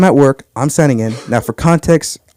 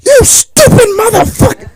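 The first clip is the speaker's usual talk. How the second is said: high-pitched, strained outburst of rage; furious caller. Retold or imitated. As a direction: explode at him down the phone. Voices the angry organiser on phone.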